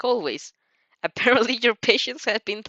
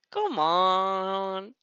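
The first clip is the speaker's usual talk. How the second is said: drawling. A narrator's reading. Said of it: "Shinzo drawls".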